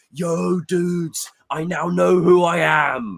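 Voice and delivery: slow, laid-back mocking dude; mock Venom as a chill party dude.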